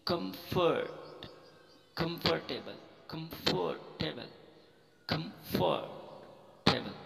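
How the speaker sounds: "कंफर्टेबल" slowly split as kum-fort-eb'l; pronunciation demo. Shown as a demonstration. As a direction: stretch "कंफर्ट" before adding the ending. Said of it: "इस तरीके से". ("कंफर्टेबल" धीरे, टुकड़ों में)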